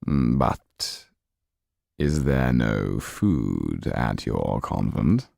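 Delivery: very slow, low and silky; sly Count. Acted. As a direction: savour each word, amused. Count questions Sido.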